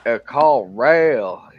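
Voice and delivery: slow, mumbled "A call rail" in a heavy backwoods drawl; mimicking a thick drawl. Mimicking the Arby's cashier's heavy Arkansas drawl. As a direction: drag out "A call rail" like an unintelligible Arkansas clerk. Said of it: "And he's like".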